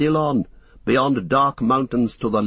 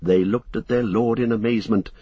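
ordinary delivery, nothing marked

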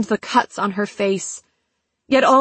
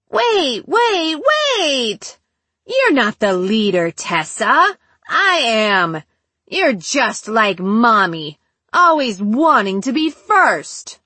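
put-on taunting child voice with swooping pitch; sing-song childlike voice; slower than usual. A narrator's reading. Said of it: "The sing-song, childlike voice again".

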